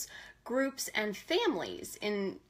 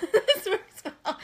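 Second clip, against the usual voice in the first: higher, louder, slow, laughing words; 8 semitones higher, 4 dB louder, slower than usual. Real, not acted. Host laughing about her notes, higher and louder. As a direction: laugh through your hope.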